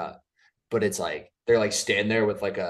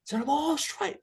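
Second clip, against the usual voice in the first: much higher, louder put-on character voice; quoting a character; 5 dB louder than usual. Repeating a favourite show quote to his co-host, in a high put-on voice. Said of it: "the other one that I was saying".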